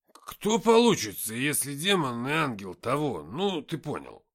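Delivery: loud, halting tipsy musing; Никита. A narrator's reading. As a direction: fumble slowly through a drunken question. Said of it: "сильно поддатый Никита". (пьяные рассуждения, громко и с растяжкой)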